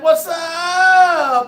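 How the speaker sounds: big loud high "What's up?" shout; a booming, high-pitched hello yell; hearty shout. Retold or imitated. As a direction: belt out the greeting across the room. Reading a greeting aloud.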